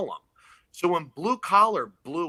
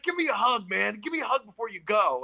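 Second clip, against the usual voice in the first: higher, pumped-up biker voice; hyped-up biker; 7 semitones higher than usual. Retold or imitated. Biker hypes the nervous comic before his set, pumped up. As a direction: pump him up like a big union biker.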